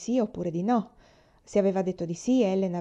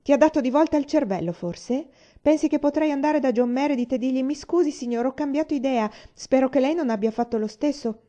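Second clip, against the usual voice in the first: higher, louder indignant outburst; flash of anger; 7 semitones higher, 6 dB louder than usual. Acted. Rosemary flaring at her sister's suggestion, indignant and raised. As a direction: scoff at the very idea, flaring up. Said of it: "ora lampeggiò nei suoi occhi azzurri".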